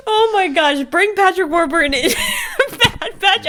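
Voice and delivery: high-pitched, breaking up mid-sentence; delighted laughing. Real, not acted. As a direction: plead excitedly through real laughter.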